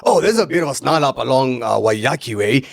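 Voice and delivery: radio voice. Retold or imitated. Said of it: "you have to fake that radio voice".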